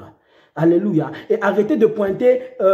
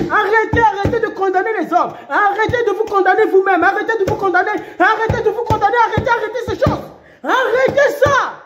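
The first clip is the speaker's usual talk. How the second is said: fiery preaching; 11 semitones higher, faster than usual. Acted. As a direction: preach it hard at the listener. Preacher commands congregation.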